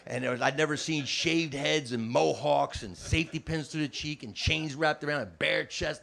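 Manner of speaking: normal talking voice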